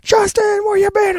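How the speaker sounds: high sing-song call of his name; imitating friends shouting his name from afar; mock friends calling. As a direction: call him like a buddy across the room.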